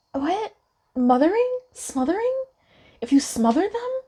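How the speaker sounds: high puzzled questions; baffled, searching aloud; confused surprise. Retold or imitated. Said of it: "I was like".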